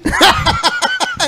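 high, squeaky burst of laughter. Real, not acted. Laughing at the impression.

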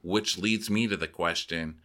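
usual speaking voice

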